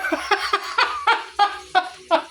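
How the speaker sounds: long, high cackle; real laughter. Real, not acted. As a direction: break into high, drawn-out laughing. Laughs at her own question.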